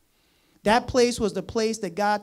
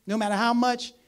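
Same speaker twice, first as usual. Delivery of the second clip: ordinary delivery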